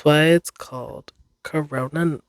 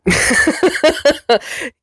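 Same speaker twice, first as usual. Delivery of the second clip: embarrassed laugh; 11 semitones higher than usual. Real, not acted. Laughs at her own flustered storytelling.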